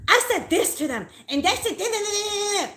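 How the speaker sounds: high, loud mocking imitation of a shrill landlady; a hammed-up high, loud impression of the landlady raising her voice. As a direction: parody her squawking over you, high and loud. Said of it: "she raises her voice".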